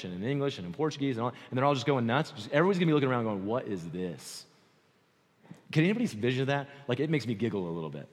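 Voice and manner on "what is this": low, slow, hushed disbelief; mock bewilderment. Onlookers looking around at chaos in church, bewildered. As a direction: drop low and draw out the baffled question.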